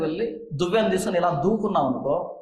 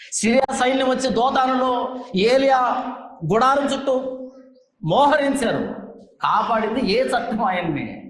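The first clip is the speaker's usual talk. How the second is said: preaching; 3 semitones higher than usual. Acted. Preacher challenging the congregation, fervent rhetorical preaching. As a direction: build each question to its punch.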